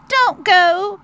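high, loud little squeak; urgent high mouse plea. Acted. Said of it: "squeaked Little Mouse".

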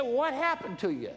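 high, loud, slow judge; judge's voice. Retold or imitated. Judge asking what changed him, high loud slow voice. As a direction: draw out the judge's amazed question.

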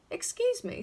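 high, odd little voice from nowhere; sudden high-pitched interruption; strange voice. A narrator's reading. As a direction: pipe up politely in a high voice. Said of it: "said the strange voice".

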